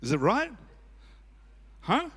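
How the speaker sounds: ordinary talk, nothing marked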